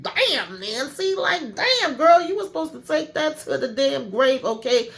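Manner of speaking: mock outraged girlfriend; dramatic scolding. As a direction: scold her like she blew the secret. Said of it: "Priscilla was like".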